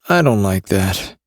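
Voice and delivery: grumbling complaint; sulky grumble; grousing. A narrator's reading. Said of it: "the smuggler groused".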